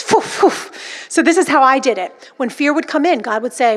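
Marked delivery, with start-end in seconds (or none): 0.0-0.5 two quick mouthed whooshes; demonstrated swishes of a sword; sword-swish noise. Shown as a demonstration. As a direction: puff out two blade swipes. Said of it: "this is how you do it".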